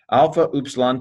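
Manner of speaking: ordinary talk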